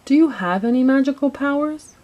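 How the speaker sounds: lightly acted child's excited asking; eager question. A narrator's reading. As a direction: ask it with eager hope. Boy eagerly asks woken girl.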